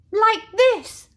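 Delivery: high, loud bright answer; cheerful child. Acted. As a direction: show them happily.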